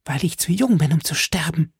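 high-pitched, pleading voice; desperate plea. A narrator's reading. Milady fights the executioner, pleading high and desperately.

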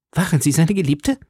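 high, insistent question; energetic demand. A narrator's reading. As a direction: demand an answer from the rival. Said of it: "mit einer Energie fort". (energisch fragend, mit hoher Stimme)

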